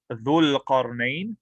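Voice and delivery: dhul qarnayn slow, with oo in dhul; marfu' form. Shown as a demonstration. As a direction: draw out dhul with its u vowel. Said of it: "marfu' would be".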